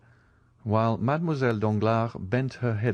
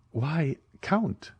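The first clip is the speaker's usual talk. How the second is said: higher, a touch startled; surprised exclamation; 7 semitones higher than usual. A narrator's reading. Eugénie noticing the ward fall ill, mildly surprised. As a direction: catch yourself in mild surprise. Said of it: "exclaimed Eugénie".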